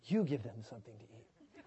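low, soft, amused Jesus; gentle low Jesus take. Shown as a demonstration. Preacher guessing how Jesus said it, low and amused. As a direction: say it low and kind, half laughing. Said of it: "I don't know if he was like".

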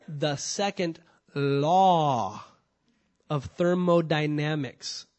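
drawn-out words, long hold on "law"; slow and deliberate. Shown as a demonstration. Preacher repeats the term slowly for his congregation, slow and deliberate. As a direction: spell it out very slowly, pausing after "law". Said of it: "let me say that again slowly".

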